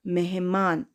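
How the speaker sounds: ordinary talk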